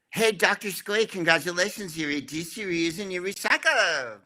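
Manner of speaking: high, cheery cartoon builder voice; Bob the Builder. Shown as a demonstration. Host asked for his Bob voice, high and cheerful. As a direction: chirp it up like a jolly kids' cartoon hero. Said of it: "And that's Bob the Builder".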